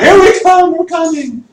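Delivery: high, loud call to the town; mock rider's call. Teacher acting out the riders' warning, high and loud.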